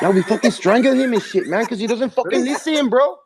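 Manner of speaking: put-on high comic Mexican accent; hammed-up Mexican-accented heavy; Mexican tough guy. Plays Mexican tough relative.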